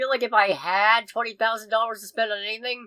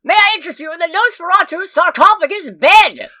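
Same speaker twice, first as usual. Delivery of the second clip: put-on loud old-timey commercial voice; old-timey ad pitchman; 8 semitones higher, 9 dB louder than usual. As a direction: pitch it like a carnival barker. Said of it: "I have an advertisement to make".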